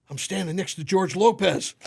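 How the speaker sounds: high, loud, laughing disbelief; blurting it out in starstruck glee; excited kid. Retold or imitated. Son excitedly whispers about celebrity.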